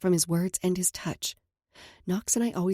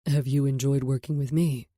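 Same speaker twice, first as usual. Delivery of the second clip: lower, tender male voice; man's voice; 5 semitones lower than usual. Acted. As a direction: speak as a calm man. Knox asks.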